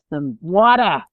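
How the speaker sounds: WATER in an exaggerated American accent, loud, pitch swooping up; hammed-up American pronunciation of water; American-style water. Shown as a demonstration. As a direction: put on a big American "wah-der" for water. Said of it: "I have to really say".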